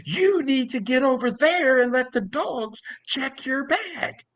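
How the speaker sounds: very high, drawn-out mock yell; slow, high-pitched pretend shouting; mock yelling back. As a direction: imitate the employee yelling back in a high put-on voice. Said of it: "And she yells back".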